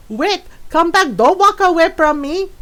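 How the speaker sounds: loud, high challenge in mom's voice; hammed-up scolding mother impression; imitated Filipino mom. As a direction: call them back like a feisty mom. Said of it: "And my mom goes".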